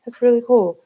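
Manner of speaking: high, bright-eyed imitation of the young rider; bubbly young woman. Driver imitates young rider saying it's cool, high and bubbly. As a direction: pitch it way up like an excited girl. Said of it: "And so she was like".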